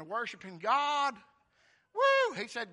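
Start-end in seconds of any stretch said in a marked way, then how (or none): 1.9-2.3 a loud, high woo of joy; excited whoop. Real, not acted. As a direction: let out a joyful holler.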